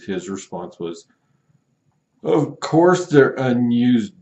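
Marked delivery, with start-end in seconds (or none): 2.2-4.1 loud, high, slowing indignant outburst; hammed-up fury at being called stupid; mock outraged customer. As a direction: buyer insulting the seller, mock outraged. Customer's angry reply read aloud.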